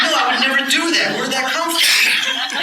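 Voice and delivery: amused incredulous protest; laughing disbelief. Retold or imitated. Laughing at his own odd thought.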